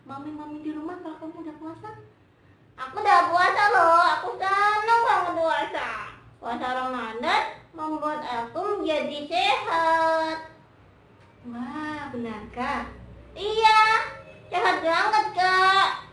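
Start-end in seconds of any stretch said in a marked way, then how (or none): 2.8-10.5 loud, high, eager puppet voice; Momon. Pretend. Momon tells children about fasting, excited puppet voice. 13.4-16.1 loud, high puppet enthusiasm; Momon. Pretend. Momon assures Kak of feeling healthy, excited puppet voice.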